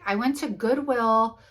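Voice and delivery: ordinary talk, nothing marked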